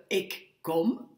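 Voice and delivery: ik kom with a short O. Shown as a demonstration. Teacher contrasting long and short sounds, kom with a short O. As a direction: keep the O in kom brief. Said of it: "on entend bien que le O est court".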